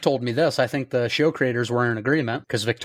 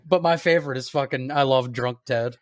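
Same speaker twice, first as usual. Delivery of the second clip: ordinary delivery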